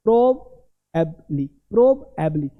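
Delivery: "प्रोबएबली" slow and low as prob, eb, lee; broken-up demo. Shown as a demonstration. Teacher breaks down probably, broken-up demo. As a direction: separate the three parts of "प्रोबएबली". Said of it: "अब ना प्रोबली बोलते हैं ना".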